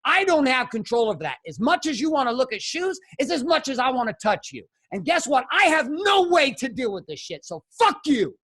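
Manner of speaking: heated rant building to a high-pitched outburst; blown-up argument. Retold or imitated. Husband blowing up at his wife in the kitchen, angry rising rant. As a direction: let loose at your partner, pitched high and building. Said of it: "And we blew up in the kitchen".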